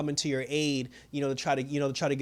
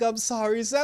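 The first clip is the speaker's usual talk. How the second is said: higher-pitched sorry imitation; mock apology; 6 semitones higher than usual. Dying villain apologizes to Sam, mock apology. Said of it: "they always apologize".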